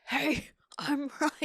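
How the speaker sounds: high, drawn-out hammed-up righteousness; mock confrontational. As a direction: make a show of being right, laughing. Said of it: "it's never like that".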